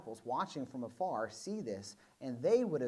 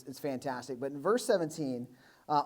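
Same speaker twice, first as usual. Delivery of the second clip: ordinary talk, nothing marked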